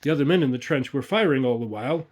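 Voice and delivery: plain reading voice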